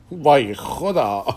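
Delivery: drawn-out words dissolving into laughter; real laughter. Real, not acted. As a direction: let the words give way to breathy laughing. Breaks into laughter.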